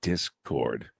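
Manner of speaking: low, soft, flat-dropping one word; deadpan answer. Retold or imitated. Husband mimicking his wife's smug reply, low deadpan. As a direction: drop the word low and dry. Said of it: "And you went".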